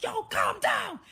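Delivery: high, loud shouted order; mock yell. Imitating the man shouting at her to calm down, loud mock yell.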